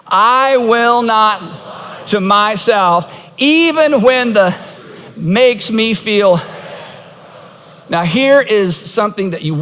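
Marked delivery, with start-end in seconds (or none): 0.0-6.4 slow, drawn-out group recital; slow unison declaration. Shown as a demonstration. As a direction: lead the congregation in saying it slowly. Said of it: "ready, everybody, all together".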